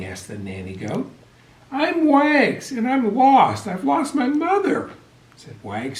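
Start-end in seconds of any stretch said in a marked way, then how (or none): 1.7-4.9 sniffling. A narrator's reading.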